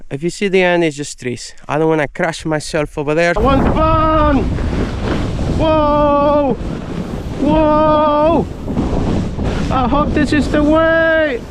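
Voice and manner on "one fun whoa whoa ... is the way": thrilled whoops. Real, not acted. Racing down the hill on sled.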